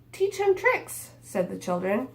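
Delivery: lively picture book read-aloud; reading to children. A narrator's reading. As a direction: read it like storytime for little kids.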